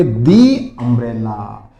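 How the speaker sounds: दी drawn out slowly before umbrella, as "dee"; slow model answer. Shown as a demonstration. Teacher to students showing the answer, slow dee umbrella. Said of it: "तो क्या बोलोगे".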